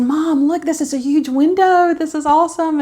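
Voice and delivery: high, eager retelling; excited. Retold or imitated. Daughter showing mom the huge hospital window, excited.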